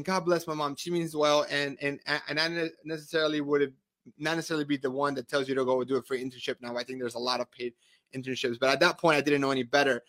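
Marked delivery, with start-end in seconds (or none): none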